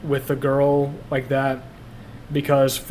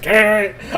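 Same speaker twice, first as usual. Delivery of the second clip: growled imitation of a choked dad; mock growl; 8 semitones higher, 5 dB louder than usual. Son retelling the noise his dad made when choked, a mock growl. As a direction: imitate his strangled growl, higher than the usual voice. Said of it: "I remember the noise he made".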